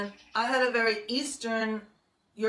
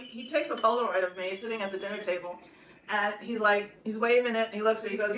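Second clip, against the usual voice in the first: ordinary talk, nothing marked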